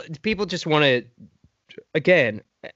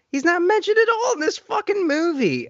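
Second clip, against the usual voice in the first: much higher, strained, fed-up rant; exasperated outburst; 13 semitones higher than usual. Real, not acted. Ranting to co-host about the movie snub, exasperated high outburst. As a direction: blurt it out in disbelief at the snub.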